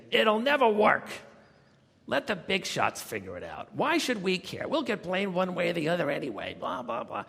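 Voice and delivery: loud, high naysayer holding forth; Daisy. Retold or imitated. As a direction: imitate a formidable complainer, opening with a shout. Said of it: "was holding forth".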